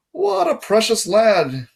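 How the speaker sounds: high, slow British-tinged drawl; strange British accent. A narrator's reading. Guard addresses the boy at his desk, strange British accent. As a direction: put on a lofty, drawn-out British voice. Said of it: "said in a strange accent, British maybe".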